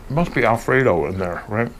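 ordinary talk, nothing marked

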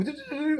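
high sung ringtone notes; imitated ringtone. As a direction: hum the video call ring.

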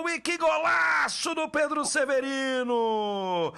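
rising to a loud goal cry; explosive goal commentary. Real, not acted. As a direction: erupt on the strike, then savor. Shot then goal.

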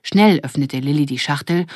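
ordinary delivery, nothing marked

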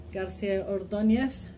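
usual speaking voice

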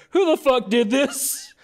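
high-pitched, drawn-out indignant cry; hammed-up outraged demand; mock outrage. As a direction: cry it out slowly in mock disbelief. Acts out outraged discovery.

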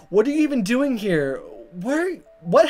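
ordinary delivery

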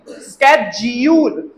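स्केड्यूल slowly as "SKED-jool"; correct American schedule. Shown as a demonstration. Teacher correcting students, स्केड्यूल as "SKED-jool". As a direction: finish स्केड्यूल on long "jool". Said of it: "स्केड्यूल नहीं".

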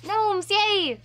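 excited cheer. Real, not acted. Cheering along with chat.

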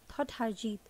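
plain reading voice

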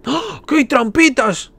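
high excited squeal; delighted at finding traps. Real, not acted. Discovers traps.

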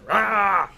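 a short, loud mock roar of rage; angry growl. Lecturer imitating his angry dad with a loud growl. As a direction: bark it out like a grumpy old man. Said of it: "He's, like, angry at everything".

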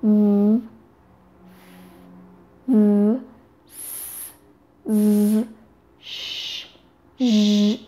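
v, s, z, sh, zh as long isolated friction sounds; sustained v, s, z, sh, zh, one at a time; fricative sounds. Shown as a demonstration. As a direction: draw out each hiss or buzz: v, s, z, sh, zh. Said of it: "The fricatives include".

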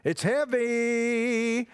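heavy stretched out long; slow, sustained, playful warning; drawn-out warning. Real, not acted. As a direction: hold the last sound of heavy. Handing over something heavy to carry.

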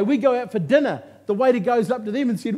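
normal talking voice

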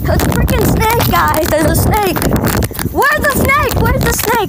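high, loud panicked yelling; screaming. Real, not acted. Kid yelling to family about a snake in the yard, screaming. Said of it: "because you're screaming so loud".

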